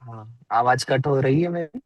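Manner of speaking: ordinary talk, nothing marked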